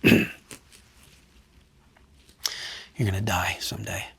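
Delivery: imitating the doctor's hushed whisper; soft unvoiced whisper, dead serious; retold whisper. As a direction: breathe it out like a quiet verdict.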